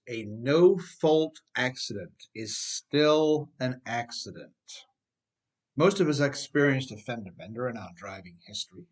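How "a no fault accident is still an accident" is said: slow, weighty stress on no-fault; emphasized maxim. Shown as a demonstration. Author stating his key rule, slow weighty emphasis. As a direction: lean on "no-fault" slowly, then let "accident" drop. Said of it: "The emphasis bar says".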